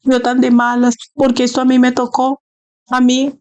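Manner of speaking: high, whiny self-pitying plea; mock crying lament. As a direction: whimper it like a believer sorry for himself. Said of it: "usted está llorando".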